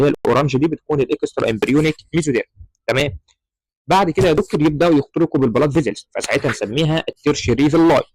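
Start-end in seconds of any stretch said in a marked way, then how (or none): none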